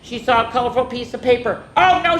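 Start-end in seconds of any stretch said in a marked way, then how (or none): 1.8-2.2 high, loud alarmed oh no; a mild cry of dismay; cried out. Acted. Hedgehog seeing the paper coming, high alarmed cry. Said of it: "she cried".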